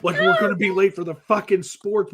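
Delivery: mild mock yell, pitched high; frantic mock yell. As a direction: hurry the kid out the door in a panic. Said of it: "you're screaming and yelling".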